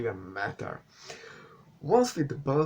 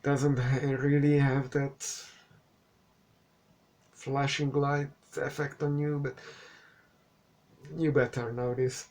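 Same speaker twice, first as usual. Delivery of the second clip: ordinary talk, nothing marked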